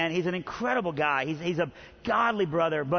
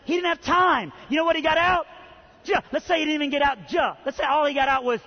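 fervent preaching; 7 semitones higher than usual. Real, not acted. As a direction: build it up and shout to the crowd. Preacher builds to fervent climax.

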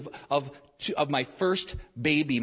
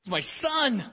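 high, loud repeated cries of joy; overjoyed father; 12 semitones higher, 3 dB louder than usual. Retold or imitated. New father filming his son's birth, high excited cries. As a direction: blurt it out, unable to believe it. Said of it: "I'm like".

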